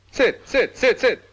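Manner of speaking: high, repeated little commands; eager urging. Acted. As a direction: usher the guest insistently into a seat. Urging visitor to take a seat.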